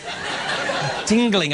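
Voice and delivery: very slow, savoured tingling; drawn-out tingle. Shown as a demonstration. Host savouring the moment for the audience, very slow. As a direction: stretch tingling out with relish.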